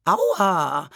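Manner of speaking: startled "ow" called out; pained cry. A narrator's reading. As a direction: cry out as if your ear hurts. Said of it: "rief die Baronin". (schmerzhafter Aufschrei)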